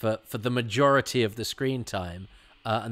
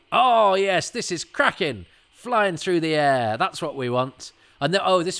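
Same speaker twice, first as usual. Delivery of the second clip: real excitement; 7 semitones higher, 8 dB louder than usual. Real, not acted. Fan watching a favourite action scene, thrilled and loud. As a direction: cheer the action on, voice lifted high.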